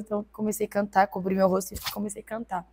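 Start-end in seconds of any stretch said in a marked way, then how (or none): none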